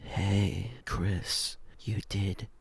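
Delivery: hushed deep fairy mommy praise; fairy mother. Retold or imitated. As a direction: murmur it like a mystical spirit.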